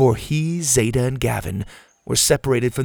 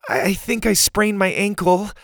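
put-on higher, halting girl in pain; hurt girl; 10 semitones higher than usual. Kiara tells Pa about her ankle, pained. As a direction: stammer through a sprained ankle.

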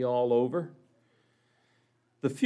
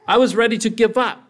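high, much louder cry; desperate outburst; 9 semitones higher, 10 dB louder, faster than usual. A narrator's reading. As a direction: burst out at the end of your endurance. Teacup at the end of hope.

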